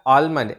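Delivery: आलमंड with a spoken L; mispronounced almond. Shown as a demonstration. Teacher warning learners about a common error, L mispronounced. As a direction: pronounce the L in आलमंड. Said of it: "गलत pronounce कर रहे हैं".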